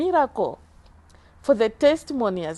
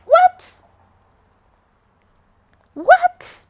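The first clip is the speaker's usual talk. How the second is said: high, loud stunned cries; disbelief; 10 dB louder than usual. Retold or imitated. Told she has no heart problem, stunned high cries. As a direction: shout it twice, unable to believe. Retold shock at heart news.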